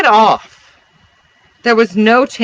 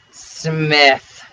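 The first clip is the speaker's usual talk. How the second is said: drawling; 2 semitones lower than usual. A narrator's reading. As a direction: drawl it out lazily. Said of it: "peculiar way of drawling out his syllables".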